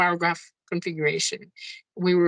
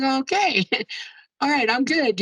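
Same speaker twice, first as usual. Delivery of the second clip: relieved laugh; 7 semitones higher than usual. Retold or imitated. Relieved reaction to finding her brush.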